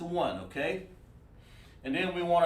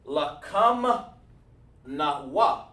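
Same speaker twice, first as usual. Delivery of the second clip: "Lakam", pause, "Nawa", each drawn out and set apart; broken down slowly; 4 dB louder, much slower than usual. Shown as a demonstration. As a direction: pronounce "Lakam Nawa" piece by piece with a long gap. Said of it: "break it down when we're saying".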